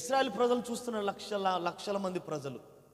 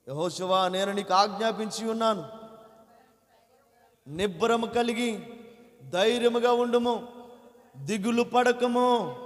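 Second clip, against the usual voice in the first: voice of God; 5 dB louder, slower than usual. Acted. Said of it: "దేవుడు మాట్లాడుతున్నాడు అప్పుడు యెహోషువతో".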